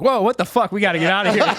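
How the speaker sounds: mock alarm, whoa blurted out; hammed-up freak-out of the tester; startled shout. Usada guy panics, retold.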